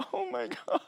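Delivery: overwhelmed exclamation. Retold or imitated. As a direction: overcome with joy, half laughing, half gasping. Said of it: "I was like".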